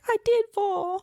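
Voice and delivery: high mock little-boy whine; high-pitched pouty kid voice; crying child. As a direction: imitate an upset child protesting. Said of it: "so he's crying and".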